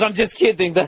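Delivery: high put-on Danish accent with fake chuckle; mock a fake "only joking" in Danish accent; imitated Danish cabbie, phony laugh; passive-aggressive Danish cabbie. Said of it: "Try to be passive-aggressive".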